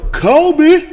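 high called-out Kobe; yelling Kobe. Retold or imitated. Shooting trash into the can, calling out Kobe. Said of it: "you instantly yell".

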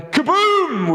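high, loud, drawn-out kaboom; explosive boom. Acted. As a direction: imitate a big explosion with your voice. Acting out an explosion.

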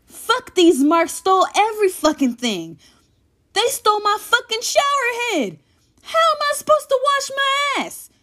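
high, loud yell from upstairs. Acted. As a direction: shout it up high in outrage. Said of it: "Dre yells from upstairs".

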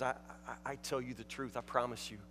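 low, hushed reassurance; soft promise. Acted. Jesus promising the dying thief, soft and low. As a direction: promise it gently, close and quiet.

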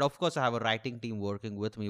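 ordinary talk

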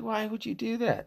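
high, mock-disgusted child; a kid baffled by adults; playful mock disgust. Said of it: "It's like".

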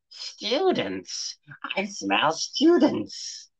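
high, slow witchy crone; old witch crone. Acted. Crone sniffing out the students, slow and witchy. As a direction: draw out students like a hungry hag.

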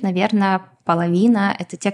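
usual speaking voice

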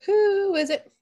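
parrot voice. Retold or imitated. Parrot asks the knocking plumber, high and squawky. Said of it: "The bird goes".

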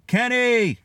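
put-on high kids' call after the truck; imitated yell. Kids hailing the water ice guy, imitated yell. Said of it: "we would all yell".

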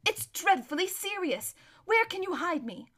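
high, worried insistence; urgent pleading. Acted. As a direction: press her to take this seriously. Runaway pleads for hiding place.

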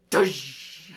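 loud sudden gun sound; mock gunshot. Cow shooting a coyote, loud gunshot noise. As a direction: fire a pretend revolver shot.

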